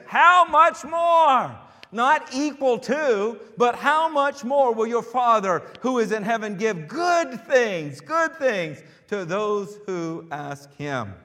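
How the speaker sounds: high, loud, slowed scripture proclamation; full-force preaching. Real, not acted. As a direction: ring out the promise to the congregation, stretching the key words.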